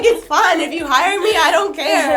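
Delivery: giggling through a mock pitch; laughing quote. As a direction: laugh while you say it. Retold job pitch, laughing.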